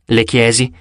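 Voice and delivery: plain reading voice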